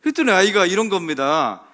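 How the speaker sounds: ordinary talk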